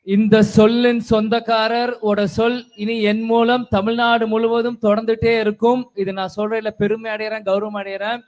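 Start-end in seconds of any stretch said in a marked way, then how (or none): 0.0-5.9 thunderous political oratory. Real, not acted. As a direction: boom it out to the crowd from the stage. Formal greeting opening stage speech.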